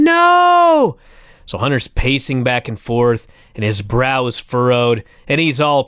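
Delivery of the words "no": a high, loud mock crowd scream of no; arena crowd yelling no at full voice. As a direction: scream it like the booing fans. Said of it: "The fans are screaming".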